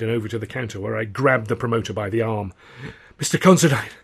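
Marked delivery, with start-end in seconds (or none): 3.2-3.9 raised, breathless rasp; loud, high winded call. A narrator's reading. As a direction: call out hoarsely after a chase. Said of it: "I rasped".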